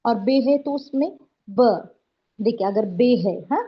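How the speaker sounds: usual speaking voice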